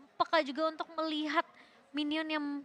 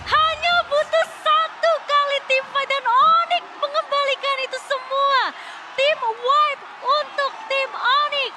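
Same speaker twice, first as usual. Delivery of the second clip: roaring hype call, much higher and louder; fighting game announcer; 10 semitones higher, 9 dB louder, slower than usual. Real, not acted. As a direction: shout it slow like a crowd is watching. Second caster hypes the comeback.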